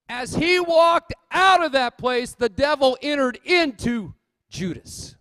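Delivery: preaching at full force. Real, not acted. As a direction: warn the church with fire. Preacher builds to a shout.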